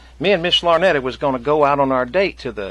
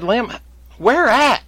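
rising alarm; 10 semitones higher, 5 dB louder, slower than usual. Retold or imitated. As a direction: voice climbing higher and higher in alarm. Demanding where boat sank.

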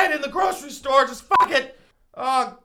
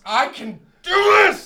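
louder shouted self-encouragement; 7 semitones higher, 6 dB louder, slower than usual. Acted. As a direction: yell it to psych yourself up.

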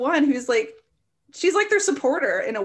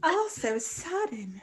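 mock Southern accent; 7 dB quieter, much slower than usual. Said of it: "let me bring on my Southern accent".

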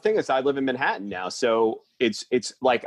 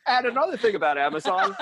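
ordinary talk, nothing marked

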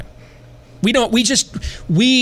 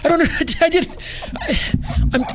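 much higher, laughing in disbelief; baffled incredulity; 7 semitones higher than usual. Retold or imitated. Answering the woman's threat, incredulous and laughing. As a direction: play it baffled, half laughing at the absurdity. Baffled retort, mock confusion.

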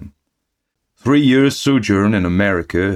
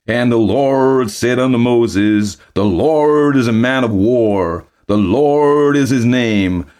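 loud, slow, higher pulpit bellow; bellowing preacher; 6 semitones higher, 7 dB louder, slower than usual. Acted. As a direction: thunder scripture from the pulpit at a crawl. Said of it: "when he bellowed".

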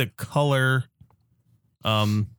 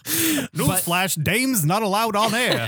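high-pitched vintage radio announcer; hammed-up old-timey newsman; old-timey newsflash voice; 7 semitones higher, slower than usual. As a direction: bark it like a mock bulletin. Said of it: "all in that old-timey voice".

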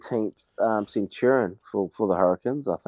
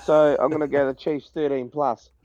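ordinary talk

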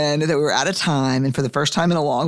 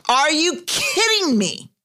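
higher, slow disbelief: are you kidding me; incredulous outrage; 13 semitones higher, slower than usual. Retold or imitated. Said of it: "I was incensed".

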